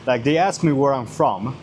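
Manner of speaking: usual speaking voice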